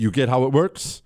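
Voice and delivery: ordinary talk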